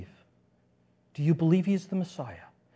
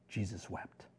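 hushed; 9 semitones lower, 14 dB quieter, slower than usual. Acted. Solemn pause after the verse.

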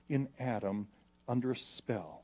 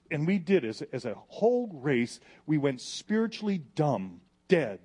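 louder, higher, driving sermon; full-force preaching; 6 semitones higher, 6 dB louder than usual. Real, not acted. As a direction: preach it hard to the crowd.

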